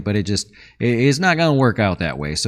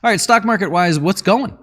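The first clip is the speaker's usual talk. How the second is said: ordinary delivery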